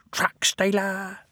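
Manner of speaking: slow whispered hiss. Acted. Ben hissing at the diesel, whispered. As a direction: hiss the insult. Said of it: "hissed Ben".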